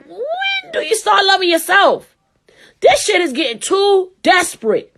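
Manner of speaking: high-pitched, exasperated rant; very upset. Real, not acted. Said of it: "I'm very upset about this".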